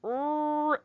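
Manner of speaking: "R" as a long front-of-mouth rrr; English R. Shown as a demonstration. Teacher shows where our R sits, long English "R". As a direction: hold "R" as a drawn-out English er. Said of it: "up here near your front teeth".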